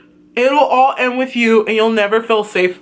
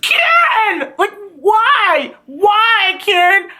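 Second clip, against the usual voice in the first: much higher, yelled disbelief at the twist; shocked outcry; 9 semitones higher, slower than usual. Real, not acted. Fan yelling at the screen over Karen's betrayal, shocked outcry. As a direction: shout the name in betrayed shock. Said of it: "viewer's own shock at twist".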